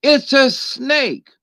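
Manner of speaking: high, slow insistence: it's a snake; drawn-out exasperated reply, pitched high; deadpan retort. Acted. Flat retort to naive optimist.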